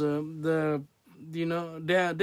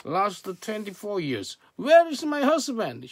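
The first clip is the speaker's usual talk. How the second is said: raised, high, angry complaint to God; angry shouting; 6 semitones higher, 5 dB louder than usual. Retold or imitated. Woman praying for a husband shouts at God, angry. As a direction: accuse God loudly, pitch pushed high. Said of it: "She was shouting like this".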